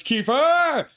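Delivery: high, loud, drawn-out name call; excited acted shout; cheering the name. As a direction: holler it like a fan in the stands. Acts out a fan's cheer.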